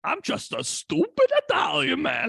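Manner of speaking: high, loud hammed-up wail; over-the-top high-pitched mock cry; mock Italian man. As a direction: shout it as a stupid-Italian caricature. Mocking a film character's line.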